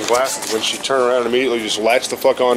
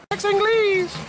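high squeal; much slower than usual. Real, not acted. Shouting out at parked cars.

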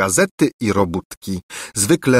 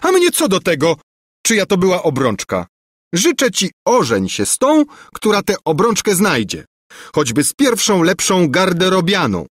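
high, contemptuous retort; scornful princess; 9 semitones higher than usual. A narrator's reading. Angelika scorns the prince's grief, high and contemptuous. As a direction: brush him off with contempt.

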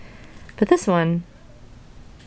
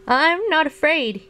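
high, drawn-out cry, no real volume; frightened mock shout; 9 semitones higher than usual. A narrator's reading. Trembling Frog insists after the avalanche, frightened mock shout. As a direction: stretch out a high, unconvincing show of bravery. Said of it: "he shouted".

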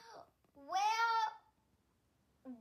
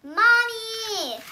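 high, loud call across the house; girl calling for her mother; calling out to Mommy; 6 semitones higher, 7 dB louder than usual. Acted. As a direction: shout for Mommy from the other room.

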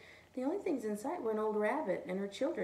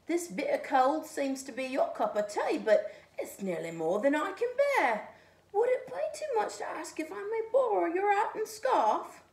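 loud, higher pleading cat; 10 semitones higher, 9 dB louder than usual. A narrator's reading. Cat asks the snowman for his hat, loud and high. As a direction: ask for the hat, cold and hopeful. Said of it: "said the cat".

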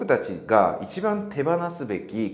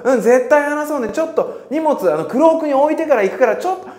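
much higher and louder, quick and chirpy; eager reply; 8 dB louder, faster than usual. Pretend. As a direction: promise to talk later, in a hurry. Friend answers before cloakroom. (クロークへ急ぐ友人の弾んだ高い声)

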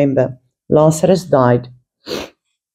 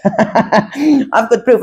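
laughing; 4 semitones higher, much slower than usual. Retold or imitated. Excited about angel photo.

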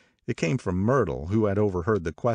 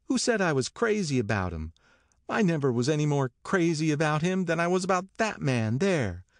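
high, indignant denial; incredulous; 10 semitones higher than usual. A narrator's reading. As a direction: protest in disbelief, pitched up. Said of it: "cried Myrtle incredulously".

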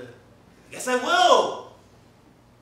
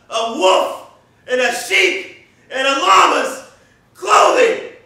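loud gleeful shouting rant; manic villain rant; 10 dB louder than usual. Acted. Unmasked villain ranting.

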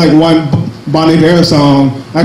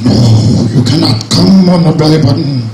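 bad Bane impression; 2 semitones lower than usual. Comic refusing a belly button request as a shitty Bane. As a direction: growl it out like a half-hearted Bane. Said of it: "That's a shitty Bane impression".

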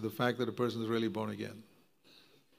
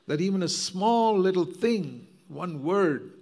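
emphatic; 5 semitones higher, 5 dB louder, slower than usual. Acted. Emphatic sermon point.

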